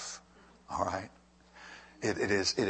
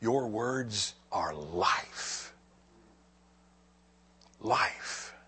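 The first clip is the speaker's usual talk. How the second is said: very slow, hushed words of Peter; slow reverent; 4 dB quieter, much slower than usual. Retold or imitated. Peter tells the Lord his words are life, hushed and slow. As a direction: let it sink in, barely above a breath. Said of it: "Peter himself who said".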